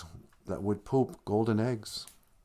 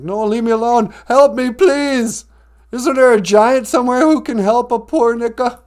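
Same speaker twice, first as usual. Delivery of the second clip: very high, loud mock distress; hammed-up shrieking damsel-in-distress goose; cry for help; 13 dB louder than usual. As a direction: wail for rescue at the top of your voice. Goose calls out for rescue.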